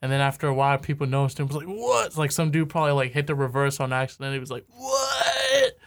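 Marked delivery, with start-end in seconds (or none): 1.7-2.1 high-pitched imitated "what"; mock astonishment. Listeners discovering hidden message. 4.7-5.8 very high, drawn-out put-on "what"; mock amazement. Listener hearing reversed record.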